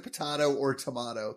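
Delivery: "potato or tomato" with AH in both middles; puh-TAH-toh, tuh-MAH-toh for "potato or tomato"; mocked pronunciation. Shown as a demonstration. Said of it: "you don't pronounce it".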